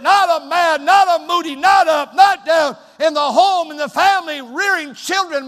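high, loud revival preaching; full-force preaching. Acted. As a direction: preach it hard, driving every phrase.

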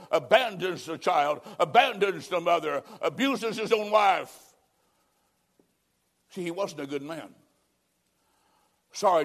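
usual speaking voice

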